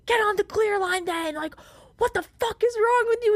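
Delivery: high-pitched imitated tirade; retold screaming. Traveler imitating the lady screaming at her, furious. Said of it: "she's screaming at me".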